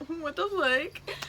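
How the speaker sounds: high, drawn-out fake whimper; hammed-up crying voice; mock tearful. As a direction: act out helpless tears, slowly. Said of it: "I had tears running down my face".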